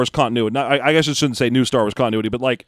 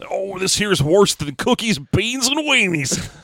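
put-on slow old cowboy voice, higher; 5 semitones higher, slower than usual. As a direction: drawl it slow and high like an old prospector. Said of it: "some sort of old cowboy voice".

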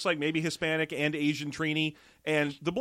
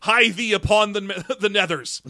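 laughing archaic exclaim; 4 semitones higher, 7 dB louder than usual. Pretend.

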